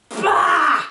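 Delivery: sudden loud "bah!" jump-scare shout; high, loud scare shout; startled bah. Retold or imitated. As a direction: blurt it out to make the listener jump. Sudden scare in ghost story.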